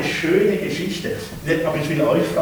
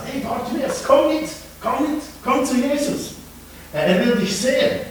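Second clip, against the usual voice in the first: eager calling; 8 semitones higher than usual. Acted. Helpers calling him.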